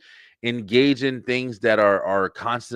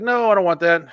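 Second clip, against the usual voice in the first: dismissive refusal; 7 semitones higher, 6 dB louder than usual. Retold or imitated. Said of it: "And then you're like".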